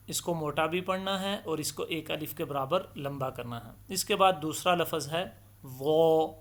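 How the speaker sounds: ordinary talk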